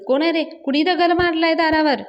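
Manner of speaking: loud and high angry shout. Acted. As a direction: yell it out the door at the stone thrower. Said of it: "मोठ्यानं ओरडत असते सुलक्षणा".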